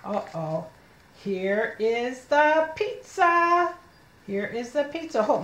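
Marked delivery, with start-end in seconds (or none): 1.2-3.7 slow, high, word-by-word reading; slow model reading. Shown as a demonstration. Teacher showing children the pizza sentence, slow word-by-word reading. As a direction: stretch each word for a beginning reader. First slow take, then fluent repeat.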